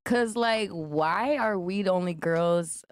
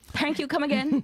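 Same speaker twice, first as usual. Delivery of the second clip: put-on high playful Indian accent; 9 semitones higher than usual. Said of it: "I was trying to do an Indian accent".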